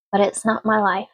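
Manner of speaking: ordinary delivery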